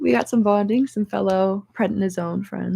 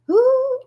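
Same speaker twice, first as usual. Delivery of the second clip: pained high ooh; 6 dB louder than usual. Real, not acted. Sudden strain while moving.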